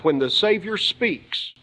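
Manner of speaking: ordinary delivery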